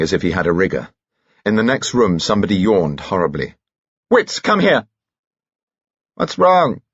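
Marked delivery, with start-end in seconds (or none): 4.1-6.8 high, loud urgent call. A narrator's reading. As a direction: shout through to the next room. Characters calling out urgently.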